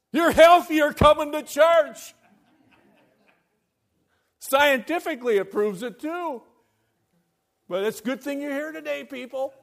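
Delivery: high, loud preacher's cry; preaching at full force. Acted. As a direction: rouse the congregation, full voice. Preaches to the congregation.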